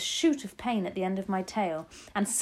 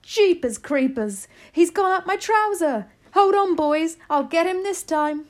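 high, loud comic outburst; shouting; 10 semitones higher, 8 dB louder than usual. A narrator's reading. Cook with a mouse up his trouser, shouting. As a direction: holler to the others in high-pitched alarm. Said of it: "the cook shouted".

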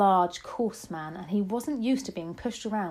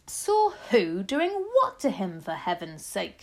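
exasperated shout; 6 dB louder, slower than usual. A narrator's reading. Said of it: "shouted Mister Jenkins".

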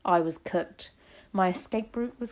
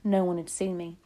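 plain reading voice

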